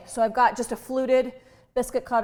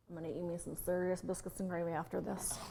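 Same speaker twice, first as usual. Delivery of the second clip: a hint of a folksy country accent, softer; unplanned folksy accent; 3 semitones lower, 11 dB quieter than usual. Cook to viewers, craving biscuits and gravy, in a light country accent. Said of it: "I do not know where that accent is coming from".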